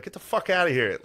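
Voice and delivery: retold irritated order to clear off; imitated angry referee. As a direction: act out shoving someone away. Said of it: "pushes Philip Rivers and is like".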